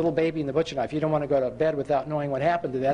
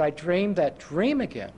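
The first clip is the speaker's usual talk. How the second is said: ordinary delivery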